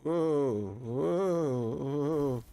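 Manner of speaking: drawn-out wondering whoa; amazed whoa. Real, not acted. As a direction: marvel at it. Amazed at something in game.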